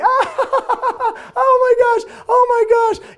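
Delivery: high shrieking laughter on each word; laughing fit. Retold or imitated. Friends at Jeff's house cracking up, high laughing shrieks. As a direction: lose it laughing, squealing high.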